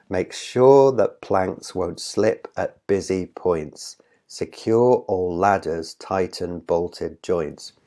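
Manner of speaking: slow, measured reading, leaning on each stressed syllable; stressed beats. Shown as a demonstration. Teacher reading the line to count stresses, slow marked beats. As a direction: read "Make sure that planks" onward deliberately, marking the beats. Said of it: "just to read out the line and count".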